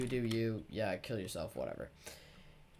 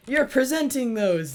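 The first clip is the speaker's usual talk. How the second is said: higher, louder, slow teacher command; bossy teacher; 10 semitones higher, 10 dB louder than usual. Retold or imitated. Teacher assigning the presentation, slow loud mock order. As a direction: lay down the order slowly. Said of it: "She's like".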